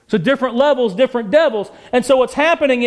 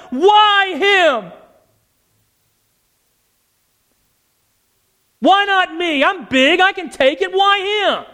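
raised, pleading cry of why him; anguished cry to God; 5 semitones higher, slower than usual. Acted. Preacher voicing a grieving father crying out to God, anguished and raised.

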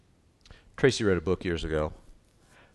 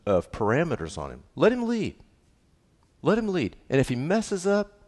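much higher, louder preaching climax; full-force preaching; 10 semitones higher, 5 dB louder than usual. Acted. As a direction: drive it home from the pulpit, ringing out.

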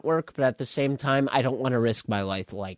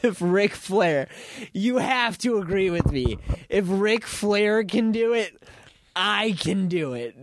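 wrestling promo; 6 semitones higher than usual. Acted. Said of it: "like I'm cutting a wrestling promo".

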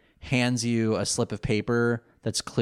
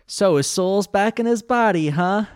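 Joey's line; 9 semitones higher, 6 dB louder than usual. Retold or imitated. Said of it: "Joey's first words to Mokuba".

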